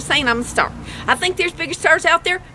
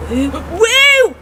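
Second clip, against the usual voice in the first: excited woo; 4 semitones higher, 5 dB louder than usual. Real, not acted. Opening a gift with two dollars.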